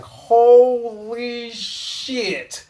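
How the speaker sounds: high, loud, drawn-out gasp of disbelief; stunned exclaim. Retold or imitated. Bystander seeing a man fly, stretched-out stunned exclaim. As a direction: let it stretch out in awe. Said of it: "You'd be like".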